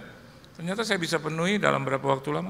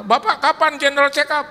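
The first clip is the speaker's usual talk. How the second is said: higher, louder sharp demand; slightly angry; 9 semitones higher, 7 dB louder than usual. Retold or imitated. Friend turns the question on pastor, a touch angry. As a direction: challenge him a bit irritated. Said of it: "sedikit marah".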